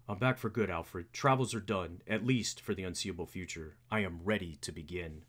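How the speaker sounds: low, resolute hero; understated low character voice; serious Bruce Wayne. Acted.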